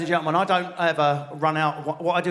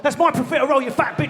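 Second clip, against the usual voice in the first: higher-pitched mock snarl over food; greedy outburst; 6 semitones higher, faster than usual. Couple fighting over the last dessert, greedy outburst.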